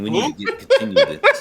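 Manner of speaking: laughing. Real, not acted. Laughing mid sentence.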